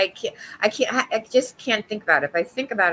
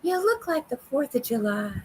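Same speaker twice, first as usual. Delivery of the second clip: higher put-on character voice; Psych Ward Turkey voice; 5 semitones higher than usual. Doing the character line she does for Mike, put-on voice. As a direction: say it like the cartoon character. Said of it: "I just have to go".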